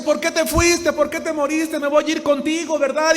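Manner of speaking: high, loud anguished cry at the grave; mock grief-stricken wail. Pastor acting out a mourner at the tomb, high anguished wail. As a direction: play a mourner throwing herself on the coffin.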